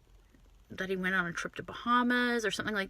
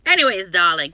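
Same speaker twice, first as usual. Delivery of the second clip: mock diva; 10 dB louder than usual. Mock-diva aside after complaint.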